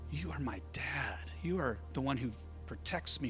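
hushed, near-whispered tenderness; intimate, tender. Acted. Voices intimate words to a father.

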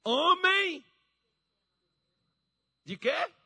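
high, very loud drawn-out call; Jesus rebuking. Retold or imitated. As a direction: call out a booming rebuke to a disciple. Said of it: "Jesus falou assim".

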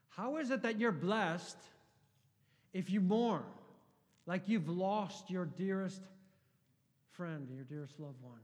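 loud, high, impassioned preaching; fiery sermon delivery; preaching at full force. Acted. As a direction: proclaim it to the back row. Passionate rhetorical question.

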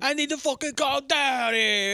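mock husband. Impersonating tea-drinking husband.

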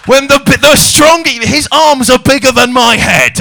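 loud, high, laughing disbelief; incredulous shout. Real, not acted. Comic to audience, loud laughing disbelief at the alpha claim. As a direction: shout it up at the crowd in mock outrage.

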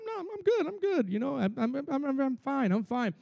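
high, soft, fake-breezy I'm fine; a mock brush-off that hides anger. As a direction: pretend nothing is wrong, lightly and high. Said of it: "You go".

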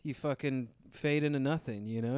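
usual speaking voice

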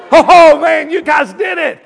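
loud, high whooping cheer; exultant shout. Pretend. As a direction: shout in jubilant amazement. Exults at congregation's response.

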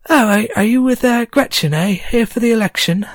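high, bright-eyed innkeeper greeting; a chatty landlady sizing up newcomers; goblin landlady. Acted. Goblin landlady greets the party, voiced high. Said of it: "the landlady says".

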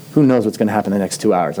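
usual speaking voice

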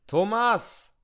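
high shout across houses; calling out. Pretend. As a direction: call out to the neighbour. Calling out to neighbour.

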